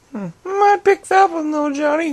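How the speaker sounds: very high, squeaky little voice; silly high-pitched commentary; put-on character voice. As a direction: pipe it up high like a puppet. Put-on voice choosing between wrappings.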